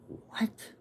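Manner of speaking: breathless whispered what; stunned what. Retold or imitated. Boy stunned when his mom mentions the lead pipe, whispered. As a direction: let it fall out in shock.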